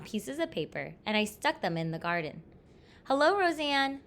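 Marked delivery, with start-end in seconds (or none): 3.0-4.0 higher, louder greeting; raised storybook call; calling across street. A narrator's reading. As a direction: hail a neighbor over the road. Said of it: "my mother called across the street".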